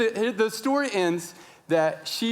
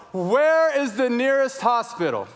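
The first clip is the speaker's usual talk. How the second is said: high, raised yell across a room; 7 semitones higher, 4 dB louder than usual. Retold or imitated. Father to kitchen and counter staff, yelling. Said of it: "And I just yell".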